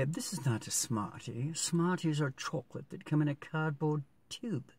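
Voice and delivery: imitated English accent, R dropped in Smartie; quoting an English person in their accent. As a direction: put on an English voice to correct someone. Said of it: "If you're from England, you would say".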